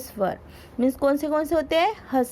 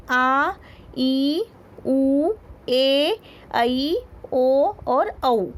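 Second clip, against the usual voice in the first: slow vowel list; much slower than usual. Shown as a demonstration. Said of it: "कौन से, कौन से?".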